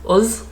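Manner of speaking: "us" voiced at the end, "uz"; northern "uz". Shown as a demonstration. Showing viewers that noise, "us" said as "uz". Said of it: "like that noise".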